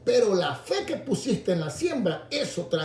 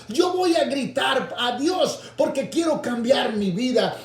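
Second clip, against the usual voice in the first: defiant resolve; 5 semitones higher than usual. Retold or imitated. Preacher voicing a believer ignoring critics, building defiant shout. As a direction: preach it stronger each line until you cry out. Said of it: "tienes que tomar fuerza y decir".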